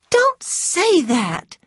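high, loud, drawn-out protest; crying out. A narrator's reading. Scarlett cries out at Rhett's remark about her hands, protesting. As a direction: cry out in slow, high-pitched distress. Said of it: "she cried".

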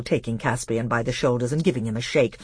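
plain reading voice

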